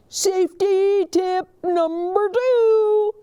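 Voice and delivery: high, slow, loud announcing voice; announcing officer. Acted. Officer Buckle addresses the school assembly, loud and slow. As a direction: announce each word slowly, like a lecture.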